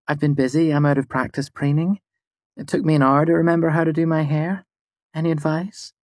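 high, quick feminine voice; pleased young woman. A narrator's reading. Fela enjoys her dress, high and quick. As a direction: chat brightly about dressing up.

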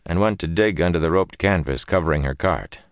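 ordinary delivery

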